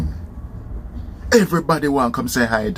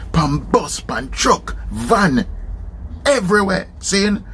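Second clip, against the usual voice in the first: ordinary delivery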